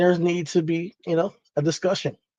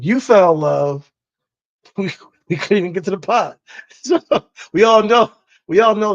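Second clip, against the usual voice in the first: laughing; 5 semitones higher, 6 dB louder than usual. Real, not acted. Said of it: "What'd you say?".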